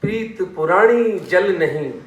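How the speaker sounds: drawn-out poetic delivery; poem recitation. Shown as a demonstration. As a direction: recite it with stretched vowels. Recites next line of couplet.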